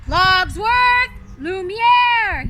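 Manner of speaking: a beast calling his servants at full volume; bellowing beast. Acted. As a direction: bellow across the castle for the servants. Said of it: "he bellowed".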